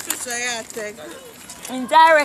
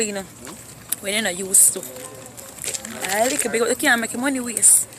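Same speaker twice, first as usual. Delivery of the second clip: ordinary delivery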